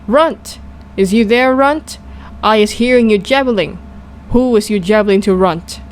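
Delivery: ordinary delivery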